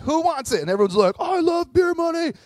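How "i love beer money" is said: high, loud cheering shout; a crowd yelling for easy money; excited crowd shout. Acted.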